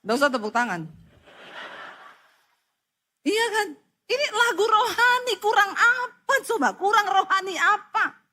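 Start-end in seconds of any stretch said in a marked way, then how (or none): 3.2-8.2 indignant rhetorical question. Real, not acted.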